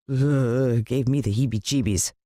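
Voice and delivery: ordinary delivery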